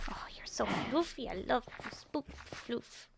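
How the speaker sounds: soft whispered cooing. Real, not acted. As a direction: coo softly at a fluffy pet. Cooing at the pet.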